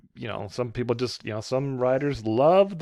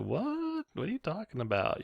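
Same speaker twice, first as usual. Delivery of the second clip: high, quiet startled what; mock-baffled reply; 9 semitones higher, 7 dB quieter than usual. Woman startled by a shouted compliment, baffled. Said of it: "and she's like".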